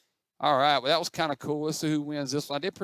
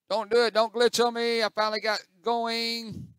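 pleading; 8 semitones higher, 5 dB louder than usual. Real, not acted. Pleads with game not to glitch.

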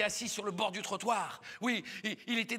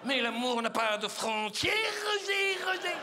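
melodramatic lovestruck woman; 3 semitones higher than usual. Acted. Oula pleads under the balcony, melodramatic lovesickness. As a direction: rise into a pleading cry of the name. Balcony love declaration continues.

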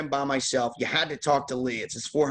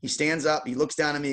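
ordinary talk

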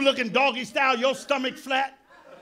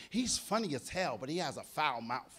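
put-on softer, prissy old-lady scold; mock a disapproving old woman; a hushed church-lady complaint; prim old lady; 7 semitones lower, 11 dB quieter than usual. Old women quoted complaining.

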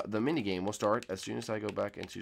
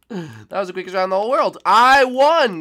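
louder, higher and fast; excited exclamation; 9 semitones higher, 10 dB louder than usual. Real, not acted. Player announcing the short round result, loud and excited. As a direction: shout the round result in delight.

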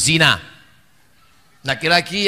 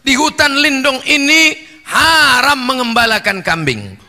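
loud, higher, emphatic parable; emphatic preaching; 7 semitones higher, 6 dB louder than usual. Real, not acted. Preacher forcefully explaining the forest parable. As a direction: hammer each point home.

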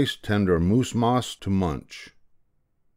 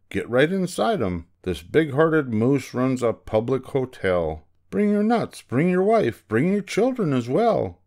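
high, hearty woodpecker invitation; calling woodpecker; 6 semitones higher than usual. A narrator's reading. Woodpecker inviting the squirrel family, calling out high. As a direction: build to a high, rallying list. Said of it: "called the woodpecker".

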